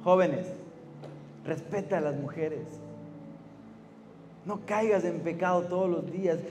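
put-on preacher to teens, gently urging them to stay pure; soft earnest appeal. Intimate soft exhortation.

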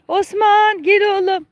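high sing-out call to an animal. Real, not acted. Owner calling Osman the donkey to come, high-pitched call.

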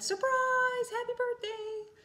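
high, bright-eyed mock shout of surprise; spring out and cheer like hidden guests; acted party surprise. Teacher acting out guests jumping out at a party, excited high squeal. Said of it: "jumped out and said".

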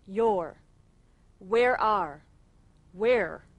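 normal talking voice

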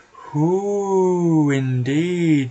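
long, swooping hoot on Hoo; drawn-out spooky villain voice; eerie taunt. Acted. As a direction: stretch it very slowly like a hooting call. Unseen villain answers who's there.

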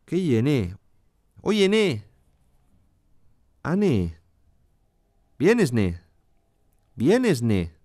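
"Oye, ne" leaping up, then dropping on "ne"; Asturian melodic descent. Shown as a demonstration. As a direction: arch up on "Vienes" and fall away. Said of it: "refuerzan o adornan la entonación del asturiano". («Vienes, ne» con subida y caída asturiana)

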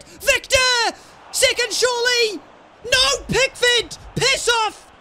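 loud, high shouts at the screen; excited yelling. Real, not acted. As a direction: yell it out as the chance flies by. Goal chance, yelling at game.

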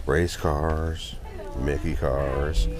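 ordinary delivery

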